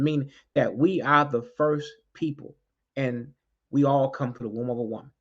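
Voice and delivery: ordinary talk, nothing marked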